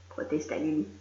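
plain reading voice

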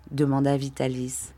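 ordinary delivery, nothing marked

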